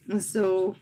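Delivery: ordinary talk, nothing marked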